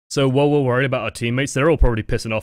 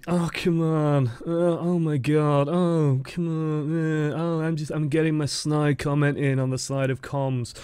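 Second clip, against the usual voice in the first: drawn-out whiny moaning; put-on grumble trailing into wordless whines; passive-aggressive whining; slower than usual. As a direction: mimic a sulky, whining teammate on comms. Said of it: "passive-aggressive whining in voice chat".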